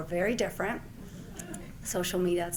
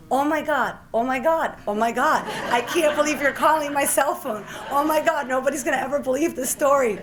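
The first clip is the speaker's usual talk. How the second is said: high, loud, giddy disbelief with laughter; retelling the moment a president phoned her cell; losing it with excitement; 5 semitones higher, 7 dB louder than usual. Retold or imitated. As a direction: gush like you can't believe who's calling. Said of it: "And I just lost it".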